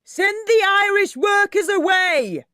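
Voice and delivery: high, loud angry yell from the crowd; angry shout. A narrator's reading. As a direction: bellow it at the house. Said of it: "shouted an angry voice".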